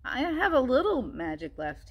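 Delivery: put-on light old frog voice; wise frog voice. Frog character voice in story.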